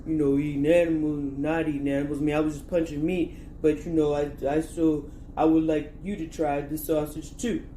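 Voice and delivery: joking bad Stallone accent; movie boxer rambling to his sweetheart; Rocky Balboa. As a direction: put on a horrible Stallone-style boxer voice. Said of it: "my horrible Sylvester Stallone accent".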